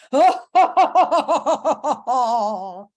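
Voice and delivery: loud, wild cackle; hammed-up maniac laugh, long and pulsing; lunatic laughter. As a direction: let rip a mad monster laugh. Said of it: "lunatic laughter".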